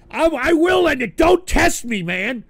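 loud, high furious warning to the chat; angry threat. Real, not acted. Threatening chat to end stream.

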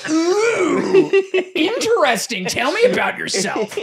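high, loud opening, drawn out after; mock fake interest. Bullshit artists humouring the ghost hunter, fake fascination. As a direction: gush with put-on fascination. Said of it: "we're all bullshit artists".

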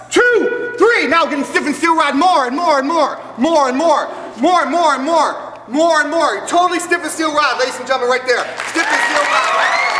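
high, loud showman commands; loud commanding. Acted. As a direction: build the suggestion into a shout. Stage hypnotist rousing crowd.

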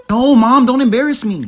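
loud, mortified plea; teen hissing at mom, loud and mortified; embarrassed teen. Acted. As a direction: beg your mom to stop, loud.

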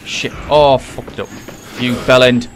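loud furious cursing; frustrated. Real, not acted. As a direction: curse at yourself for messing up. Cursing after failing the escape.

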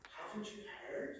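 hushed whisper. Acted. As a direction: breathe it as a secret. Rhetorical question in reading.

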